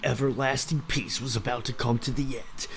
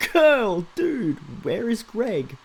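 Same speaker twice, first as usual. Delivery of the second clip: mock character voice; 8 semitones higher, 4 dB louder, much slower than usual. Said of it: "dude speaks like that".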